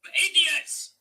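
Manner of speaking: sharp high angry shout; fan's furious cry at his defenders; angry outburst. Real, not acted. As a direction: spit it out at the players. Fan reacts to another goal.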